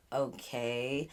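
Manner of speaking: low, soft flat-toned okay; deadpan okay. Retold or imitated. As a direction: drop it, unimpressed. Deadpan reaction passing stores.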